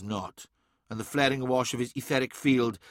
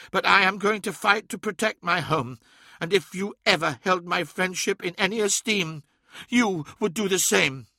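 higher voice, firm and determined; resolute Ammon; 7 semitones higher than usual. A narrator's reading. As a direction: vow to defend home.

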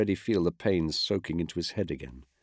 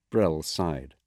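ordinary delivery

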